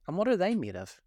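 high, eager question; curious. A narrator's reading. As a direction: ask with great curiosity. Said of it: "in a tone of great curiosity".

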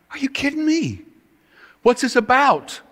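high, incredulous outburst; a shocked, high "are you kidding me"; indignant disbelief. Retold or imitated. Accused at meeting.

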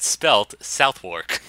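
drawn out slowly, "Southwark" said carefully; slow spelling out. Shown as a demonstration. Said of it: "but pronoun".

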